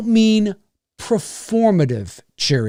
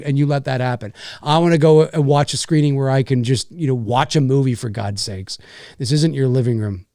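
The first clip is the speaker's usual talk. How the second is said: ordinary talk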